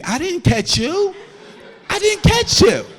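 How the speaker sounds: rising to a high shouted protest; high shouted I didn't catch you; panicked denial. Acted. Denying big girl caught him.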